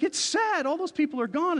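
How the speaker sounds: very high, upset protest; mock distraught. Upset brother explaining the film deaths, mock distraught. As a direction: imitate a fan pleading over the loss, pitch way up.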